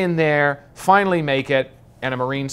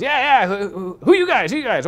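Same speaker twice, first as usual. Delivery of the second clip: much higher, eager and chummy general voice; excited Texan general; 10 semitones higher, 6 dB louder than usual. Retold or imitated. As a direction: play the little Texan general, thrilled to meet them. Retold general from Texas talking.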